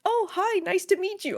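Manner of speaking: high, bright sing-song hello; chirpy villager greeting. Retold or imitated. Villager who has forgotten the player, high chirpy greeting. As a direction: greet a stranger cheerfully, high up. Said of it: "they were like".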